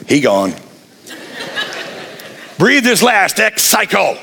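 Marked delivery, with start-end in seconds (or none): 0.1-0.5 Duck Dynasty quote. Retold or imitated. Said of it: "Like you say in Duck Dynasty".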